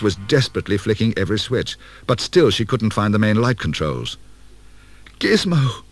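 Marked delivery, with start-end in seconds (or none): 5.2-5.8 much higher desperate call; cried out. Acted. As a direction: Kate calling out in the dark. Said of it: "she cried".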